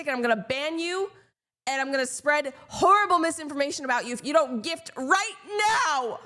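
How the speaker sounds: over-the-top fake threats to get a gift; mock threatening rant. Escalating mock threats to viewer.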